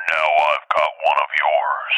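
very low, slow creature voice; husky, drawn-out threat; menacing elephant voice. Retold or imitated. Mama elephant to the dad holding her baby, low slow menace.

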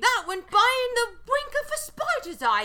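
high, loud British caricature. Acted. Mocking surprised British contestants in a hammed-up British accent. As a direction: lay on a silly, over-the-top British accent. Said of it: "the most offensive British accent".

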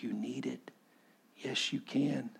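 very soft imitated fatherly reassurance; gentle insistence. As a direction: insist quietly, as a father to his son. Dad insists on giving the money.

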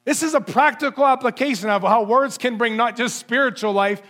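usual speaking voice

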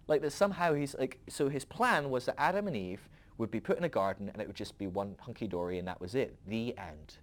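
normal talking voice

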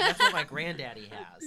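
high and loud, laughing through a friend's jab; laughing mock tease. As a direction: crack up while quoting the friend. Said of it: "'Cause David used to be like".

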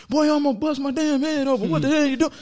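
high, quick retold outburst; mock angry dad. Dad scolding son over fallen branch, high mock anger. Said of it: "he was mad".

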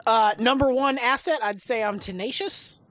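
usual speaking voice

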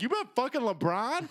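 high, teasing jab; mock accusation. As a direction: needle her about the giant shoes. Mocking the huge shoes.

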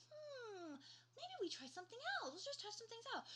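very high, tentative director voice; tactful suggestion. Retold or imitated. Director nudging the actor to try something else, tactfully high-pitched. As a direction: float it up high as a diplomatic suggestion.

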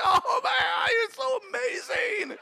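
very high, squealed praise to God; tearful laughing rapture. Retold or imitated. Student swaying in the chair praises God, tearful laughing rapture. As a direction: re-enact helpless joy, voice way up high. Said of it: "I'm laughing uncontrollably".

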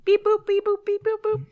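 high beeping robot noises; toy robot voice. Pretend. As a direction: beep like a little toy robot. Robot noises piloting ship.

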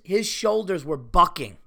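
normal talking voice

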